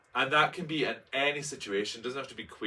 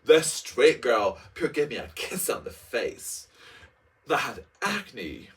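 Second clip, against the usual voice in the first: slow, hammed-up grandeur; mock dramatic reading; 2 semitones higher, 5 dB louder, slower than usual. Reading own memoir line in slow mock drama. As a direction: savor every word like melodrama. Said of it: "love how disgusting I'm being by saying".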